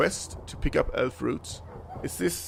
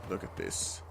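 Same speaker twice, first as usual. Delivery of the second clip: whisper; 7 semitones lower, 12 dB quieter than usual. Real, not acted. Whispered aside while playing.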